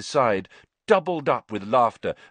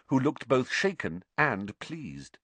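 usual speaking voice

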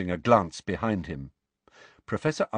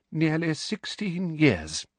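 slow, higher, grudging answer; deeply resentful; 8 semitones higher, slower than usual. A narrator's reading. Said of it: "in a deeply resentful tone".

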